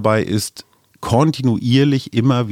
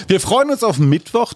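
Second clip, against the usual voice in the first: laughing; 8 semitones higher than usual. Real, not acted. Laughs after teasing Suse.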